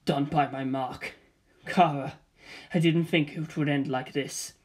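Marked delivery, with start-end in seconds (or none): none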